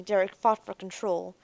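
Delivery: plain reading voice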